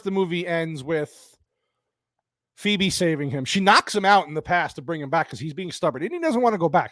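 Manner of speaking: ordinary talk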